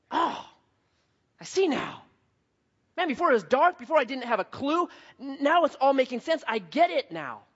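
wondering "now I get it" voice; dawning realization. Acted. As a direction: act out the light coming on. Acting out sudden realization in dark.

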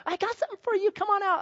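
high, sugary-sweet mom voice. Retold or imitated. Mom knocking for Audrey, high mom voice. Mom luring daughter to car.

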